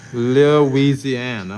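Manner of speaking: a long, loud hometown shout-out; drawn-out shout-out. Real, not acted. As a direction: stretch Louisiana out slow and loud. Streamer greets viewer's home state.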